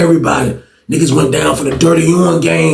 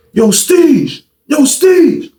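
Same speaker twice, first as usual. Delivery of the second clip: high-pitched holler through a cell door; acted summons from outside a door; calling out; 13 semitones higher, much slower than usual. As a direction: call him out, pitch climbing on the repeat.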